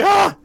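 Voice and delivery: high, loud yelp of alarm; acted startled scream. Game master acts out the driver, startled mock scream. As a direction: cry out in sudden fright, hammed up. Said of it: "You're like".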